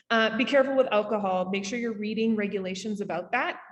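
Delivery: ordinary talk, nothing marked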